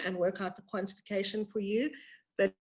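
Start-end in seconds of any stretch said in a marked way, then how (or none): 2.4-2.5 choppy, breaking-up connection audio; words sped and clipped by a bad line; buffering stutter. Real, not acted. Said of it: "the screen was just breaking up".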